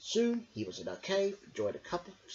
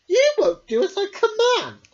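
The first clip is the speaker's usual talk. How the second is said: high, loud whiny royal command; a spoiled king stamping his foot; petulant king; 15 dB louder than usual. A narrator's reading. King scolding Merrick, high and loud.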